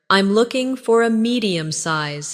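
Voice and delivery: ordinary talk, nothing marked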